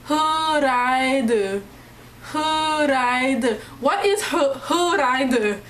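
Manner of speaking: "huraide" repeated as hoo-rai-duh, vowels held; acting out baffled menu readers stuck on "huraide"; puzzled sounding-out. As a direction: sound out "huraide" as an unknown Korean word. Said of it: "kept sitting there repeating".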